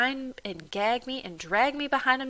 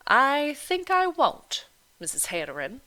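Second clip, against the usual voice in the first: a touch of a firm refusal; lightly voiced doctor refusing; 7 semitones higher, 5 dB louder than usual.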